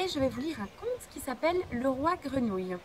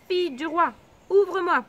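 loud call through the door, higher; frog calling; 5 semitones higher, 8 dB louder than usual. Acted. Frog at the door calling the princess, much louder.

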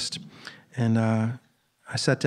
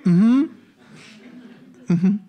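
high, loud rising mm-hmm; eager agreement; 7 semitones higher, 5 dB louder than usual. Retold or imitated. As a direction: pipe up like an overeager fan. Eager reply to his musical hero.

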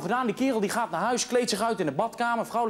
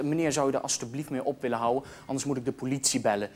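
lower, softer stern warning; stern woman; 8 semitones lower, 4 dB quieter than usual. Acted. Cinema woman warning the moaning man. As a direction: warn him quietly and firmly.

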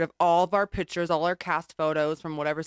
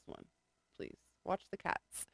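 whisper; 16 dB quieter than usual. Real, not acted. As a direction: whisper it so the call doesn't hear. Whispering to child.

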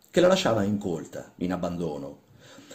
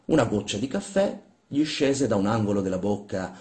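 ordinary delivery

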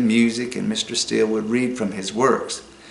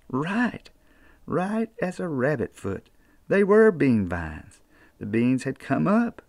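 unhurried Appalachian folk-tale telling; storytelling narration; 5 dB quieter than usual. A narrator's reading. Storyteller reading a children's tale to listeners, easy and folksy. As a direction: spin the yarn like a mountain storyteller.

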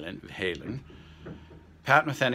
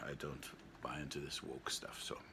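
whispered aside; 5 semitones lower, 14 dB quieter than usual. Real, not acted. Muttered aside about woke stuff.

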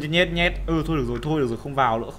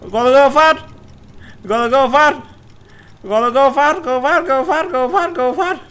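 higher and higher loud urging; 9 semitones higher, 5 dB louder, slower than usual. Real, not acted. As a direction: egg the runner on, building up. Urging character, building. (thúc giục ngày càng cao)

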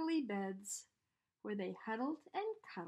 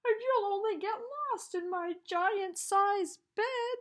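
louder, higher crying bear; giant bear's voice; 10 semitones higher, 8 dB louder than usual. A narrator's reading. The bear complains its teddy is too small, loud wailing voice. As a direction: wail at the tiny teddy. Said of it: "it said".

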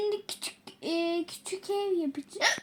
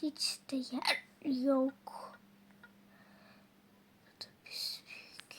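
whispering; 4 semitones lower, 8 dB quieter than usual. Real, not acted. Muttering to self while building.

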